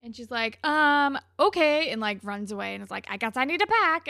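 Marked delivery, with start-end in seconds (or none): 0.7-1.9 loud, high, huffy reply; retold miffed girlfriend; really annoyed. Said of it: "is, like, really annoyed about it". 3.1-4.1 high, loud grudging huff; mock-petulant announcement; really annoyed. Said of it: "is, like, really annoyed about it".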